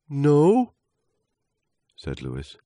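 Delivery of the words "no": very high, loud little voice; small leaf. Acted. Leaf answering trunk's quiz, high little voice.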